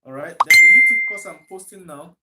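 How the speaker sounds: ordinary delivery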